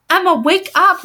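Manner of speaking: high loud call; shouting. A narrator's reading. Mother shouts at thrashing Emma, urgent. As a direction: shout her awake. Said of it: "Mother shouted".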